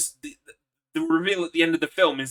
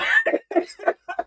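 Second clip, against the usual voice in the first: high cackling laugh. Real, not acted. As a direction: burst into a high helpless cackle. Laughing after shout.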